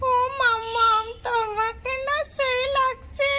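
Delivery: put-on high, slow child teasing mum; a small kid mocking the makeup; high giggly child voice.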